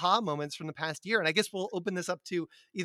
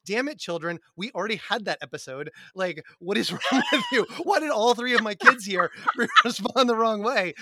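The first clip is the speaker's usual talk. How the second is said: mock exasperated teacher; 5 semitones higher, 6 dB louder than usual.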